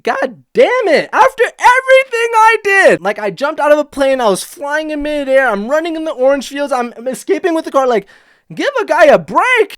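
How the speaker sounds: imitating the fed-up doomed driver; exasperated complaint. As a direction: whine like the universe is against you. Voicing the abducted guy's frustration.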